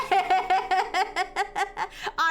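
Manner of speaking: long loud laugh trailing off. Real, not acted. Still laughing at the aunt's slip, long laugh. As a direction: laugh hard and let it fade.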